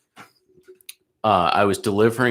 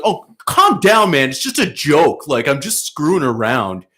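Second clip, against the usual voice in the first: mocking drunk kid; 5 semitones higher, 4 dB louder than usual.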